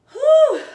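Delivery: loud, high exhale of exhaustion; breathless whew. Real, not acted. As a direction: blow out the air after a hard set. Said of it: "Already out of breath".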